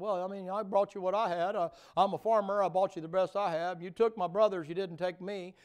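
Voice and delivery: high, loud, aggrieved whine of a hurt farmer; Cain complaining. Acted. Cain protesting to the Lord, high and loud. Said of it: "He said".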